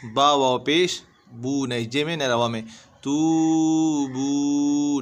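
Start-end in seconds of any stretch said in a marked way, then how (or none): 3.0-5.0 तूबू with both oo sounds held very long; both vowels drawn out. Shown as a demonstration. Teacher showing two wav madds, तूबू stretched long. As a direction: stretch तूबू slowly on each syllable. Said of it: "दोनों में वाव मद्दा है".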